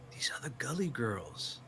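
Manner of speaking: unvoiced hushed whisper. [name] introducing the Gully Girls to Mabel in a whisper. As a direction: whisper it like a secret.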